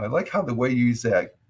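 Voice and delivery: ordinary talk, nothing marked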